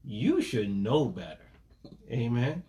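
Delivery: normal talking voice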